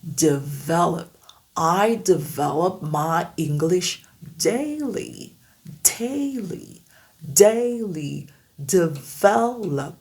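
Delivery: develop slow with English D; I develop my English daily, slowly; English develop drill. Shown as a demonstration. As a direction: develop lentement à l'anglaise. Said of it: "asseoir la prononciation".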